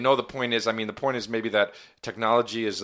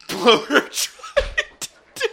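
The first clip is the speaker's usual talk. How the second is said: laughing; 14 semitones higher, 5 dB louder, slower than usual. Real, not acted. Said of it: "It's amazing".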